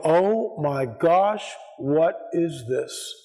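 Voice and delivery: dumbfounded. Retold or imitated. Visitor retelling his first sight of the mound, dumbfounded. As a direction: stretch each word as if stunned, retelling it. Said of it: "I mean, dumbfounded".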